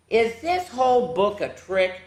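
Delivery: ordinary delivery, nothing marked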